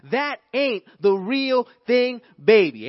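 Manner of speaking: slow, loud, drawn-out preacher's punch; preaching shout. Acted. Preacher to congregation, a loud slow clinch. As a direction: hammer the line home to the pews.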